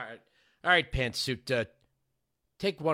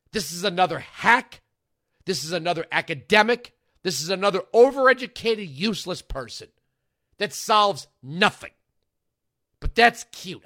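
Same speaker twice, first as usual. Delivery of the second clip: higher and louder, scornful; angry rant; 4 semitones higher, 6 dB louder than usual. Real, not acted. Host scorning the guest expert, loud and angry. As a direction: tear her down with contempt.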